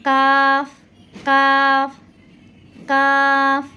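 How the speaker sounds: কাফ thin and light, not heavy; কাফ crisp and forward; light Arabic kaf. Shown as a demonstration. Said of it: "এই কাফটা একটু পাতলা করে পড়তে হয়".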